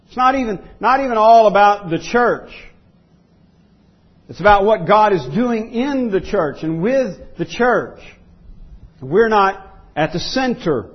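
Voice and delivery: emphatic preaching. Real, not acted. Preacher telling the church they're not at the center, emphatic preaching. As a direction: hammer out each repeated denial, high and forceful. Preacher drives point home forcefully.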